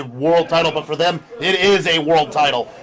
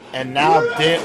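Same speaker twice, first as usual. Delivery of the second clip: ordinary talk, nothing marked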